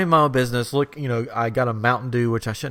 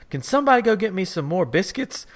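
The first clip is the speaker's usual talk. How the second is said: imitated fussy lady; 7 semitones higher than usual. Retold lady demanding more biscuits.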